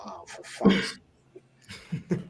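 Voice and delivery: muttered under the breath, almost voiceless; an exasperated hushed curse to himself; dismayed inner groan. Retold or imitated. Dreading inner thought as fan approaches.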